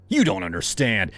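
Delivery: loud, raging outburst; furious accusation. A narrator's reading. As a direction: spit out the accusation. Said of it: "rage boiling up".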